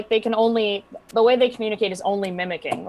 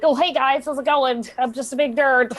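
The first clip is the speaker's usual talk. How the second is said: higher-pitched nerdy character voice; weird nerdy voice; 5 semitones higher than usual. Shown as a demonstration. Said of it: "like, a weird nerdy voice".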